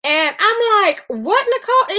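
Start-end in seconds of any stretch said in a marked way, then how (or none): 1.1-2.0 high-pitched, incredulous reaction; retold shock. Telling y'all how shocking Nicole was, retold disbelief. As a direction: act out disbelief, voice jumping high. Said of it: "it was just so shocking".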